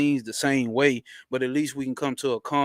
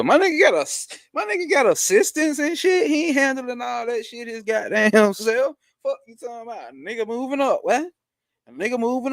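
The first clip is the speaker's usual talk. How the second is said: much higher, amused and impressed; hyped up; 7 semitones higher than usual. Real, not acted. Host hyping his friend's new assistants, amused and impressed.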